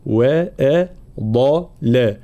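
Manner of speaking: وأضل syllable by syllable, thin hamza, heavy dad, thin lam; heavy dad between light letters. Shown as a demonstration. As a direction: split وأضل slowly, thickening only the dad. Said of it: "وبينهما الضاد مفخمة". (وأضل بتفخيم الضاد وترقيق ما حولها)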